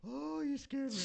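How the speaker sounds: high, wailing mock relief after a fright; scared older woman. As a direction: imitate a startled woman doubled over. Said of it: "she's, like, doubled over".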